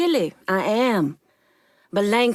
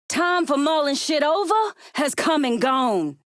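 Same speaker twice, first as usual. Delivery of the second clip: impatient shout; 6 semitones higher than usual. Acted. Crime boss pushing an ally.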